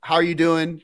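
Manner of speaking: usual speaking voice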